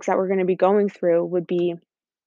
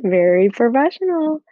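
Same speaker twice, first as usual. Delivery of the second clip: mock professional; 4 semitones higher, 4 dB louder than usual. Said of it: "See, did you hear that word?".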